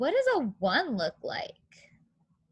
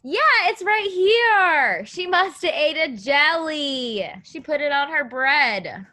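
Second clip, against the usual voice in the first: excited find; 4 semitones higher, 6 dB louder than usual. Real, not acted. Teacher spotting the jelly in the picture, excited. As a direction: celebrate the find.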